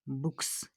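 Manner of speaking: बुक्स ending in a crisp S hiss; final S sound. Shown as a demonstration. Teacher showing pupils the end sound, final S in बुक्स. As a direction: say बुक्स with a clear voiceless S at the end. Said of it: "स sound निकलता है last पे".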